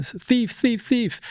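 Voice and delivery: high, held-back mock shout; imitated alarm call. Robbed man calling after the thief, high mock yell.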